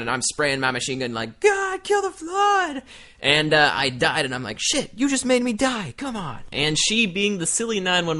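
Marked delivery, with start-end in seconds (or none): 1.4-2.8 high, drawn-out gamer yell; mock battle cry. Gamer firing a machine gun in Halo, mock battle cry. 4.6-6.4 high-pitched, whiny gamer complaint; mock exasperation. Gamer blaming a caller for his death, mock exasperation.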